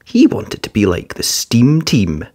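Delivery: usual speaking voice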